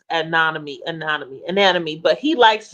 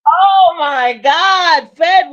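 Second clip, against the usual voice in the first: slow, louder, higher drawn-out cry; exasperated oh my God; 8 semitones higher, 8 dB louder, much slower than usual. Real, not acted. Frustrated at getting no answer, drawn-out loud exclamation. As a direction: stretch it out in disbelief.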